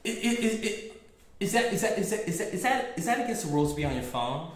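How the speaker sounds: flustered stutter, then rushing; stammer, then hurry the question out; mock stammer. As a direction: imitate a customer tripping over words. Flustered customer stammering, retold.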